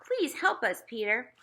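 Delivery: high, begging mother voice; mother begging her older son to help, pleading. Acted. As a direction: beg your son for help. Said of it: "She said".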